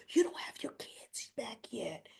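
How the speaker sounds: whispered and slow; imitating Drew's hushed whisper; mock whisper. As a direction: hiss it softly to unsettle her. Drew whispering to Willow.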